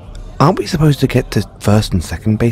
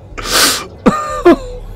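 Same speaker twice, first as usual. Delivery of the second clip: high delighted giggle; squealing little giggle. Real, not acted. Flustered by a flirty reply.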